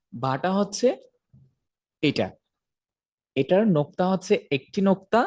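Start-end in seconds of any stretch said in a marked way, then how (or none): none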